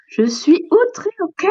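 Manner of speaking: high, slow mock indignation; outraged. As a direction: draw out the outrage, pitched high. Turtle insists on being outraged. (indignation aiguë et traînante)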